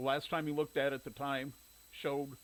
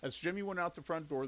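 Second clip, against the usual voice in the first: ordinary delivery, nothing marked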